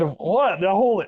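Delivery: high-pitched mock startled blurt; retold shock. Kid reacting to his stray first shot, mock startled. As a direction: act out a stunned reaction, lightly hammed-up.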